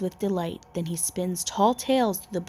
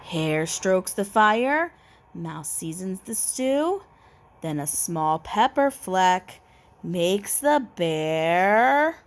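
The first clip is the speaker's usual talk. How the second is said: ordinary delivery, nothing marked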